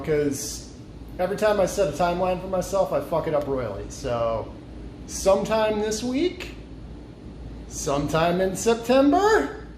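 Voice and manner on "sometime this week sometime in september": rising comic build. Real, not acted. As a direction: stretch each deadline out, climbing higher. Escalating joke deadlines.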